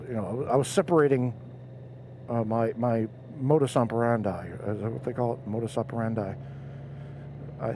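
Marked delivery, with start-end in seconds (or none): none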